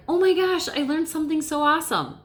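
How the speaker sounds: light up like you just discovered something; excited delight; thrilled. Shown as a demonstration. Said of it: "your tone of voice is different".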